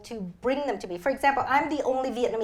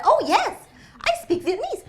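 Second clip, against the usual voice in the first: bright, higher fake confidence; overconfident claim; 13 semitones higher, 6 dB louder than usual. Young reporter bluffing to interviewers: high breezy fake confidence. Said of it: "Of course I said".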